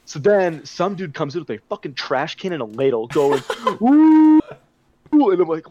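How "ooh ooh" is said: high, loud imitated monkey hoots; monkey noises. Party guy banging a trash can, monkey hoots. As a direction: hoot like a monkey. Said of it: "making monkey noises".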